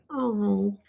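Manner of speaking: ordinary delivery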